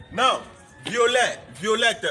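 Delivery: violet as vee-oh-LAY, violette as vee-oh-LET, both loud; loud model words. Shown as a demonstration. Teacher to class, calling words out loud. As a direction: call the two forms out for the class. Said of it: "Let's go".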